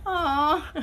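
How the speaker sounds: laugh-cry. Real, not acted. Touched by a viewer's comment.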